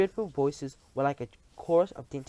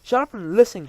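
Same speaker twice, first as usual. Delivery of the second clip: loud, high, sharp command; snapped; 7 semitones higher, 11 dB louder than usual. Acted. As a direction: cut them off harshly. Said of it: "snapped the Grand High Witch".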